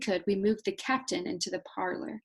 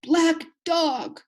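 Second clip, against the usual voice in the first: high, loud alarm; waking sailor's loud, high cry; startled cry; 9 semitones higher, 7 dB louder than usual. Acted. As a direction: call out the name in fright. Captain wakes crying out.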